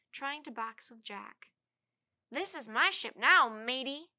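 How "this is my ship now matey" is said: pretend pirate. Tad playing pirate.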